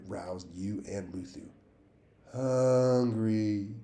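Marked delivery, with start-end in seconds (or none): 2.3-3.7 long, louder, higher moan. A narrator's reading. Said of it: "you moaned".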